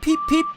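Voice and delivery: very high, loud train peep; whistle peep. A narrator's reading. Edward whistling as he puffs away, high and bright. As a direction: pipe it like a tiny whistle. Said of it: "he whistled".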